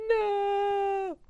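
a very high, drawn-out hammed-up "No!"; Luke's anguished no. Shown as a demonstration. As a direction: wail "No!" like Luke learning the truth. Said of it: "And Luke's just like".

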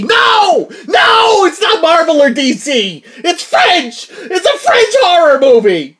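high, loud exasperated yelling; dramatic shout. Acted. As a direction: yell it out, half laughing. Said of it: "I'm a little dramatic".

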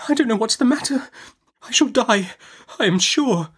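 high-pitched, fretful, in short bursts; faltering. A narrator's reading. Mother fears she is dying, faltering. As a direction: fret in a high voice, pausing between phrases. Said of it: "faltered my mother".

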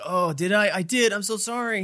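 high, mock-flustered apology; regretful. Imitating him apologizing after they pull away, high-pitched regret. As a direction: imitate a drunk man's sudden regret. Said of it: "regretful of this".

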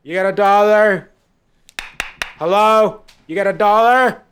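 very high, loud nagging plea; insistent begging woman. Retold or imitated. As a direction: keep pestering at the window. Woman at his window.

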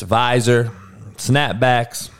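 ordinary talk, nothing marked

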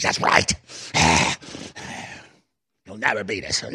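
breathy demonic whisper with a long exhale, lightly done. Retold or imitated. Host mimicking globalist guest gloating, hint of demon. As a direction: whisper the threat like something evil. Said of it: "He actually talked like a demon".

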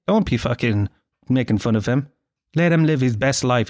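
ordinary talk, nothing marked

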